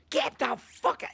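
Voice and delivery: high-pitched, loud furious dismissal; professor going nuts. Retold or imitated. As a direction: throw them out, yelling like an outraged professor. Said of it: "he's going nuts".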